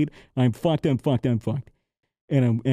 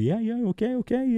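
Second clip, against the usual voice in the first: high-pitched string of agreement; imitated airline manager; 10 semitones higher than usual. Manager letting traveler on, high placating voice. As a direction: give in with a raised, lighter voice. Retold manager accepting the results.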